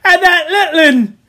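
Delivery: high, loud gasping cry; Piggy gasping. A narrator's reading. Piggy realises a child is missing, gasping alarm. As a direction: force it out while fighting for breath. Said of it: "gasped Piggy".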